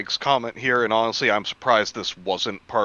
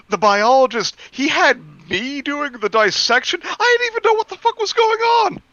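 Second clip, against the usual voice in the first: much higher, flustered intern voice; mock clueless intern; 14 semitones higher than usual. Friend acting a fake intern troll story, high flustered voice. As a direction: squeak it out like a panicked intern.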